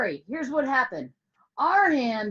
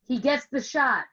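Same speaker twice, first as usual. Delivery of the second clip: normal talking voice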